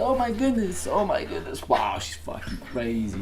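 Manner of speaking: high, soft soothing pet voice; calm the squirming puppy. Real, not acted. Soothing the wriggling dog.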